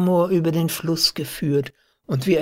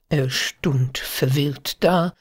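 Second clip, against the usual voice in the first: plain reading voice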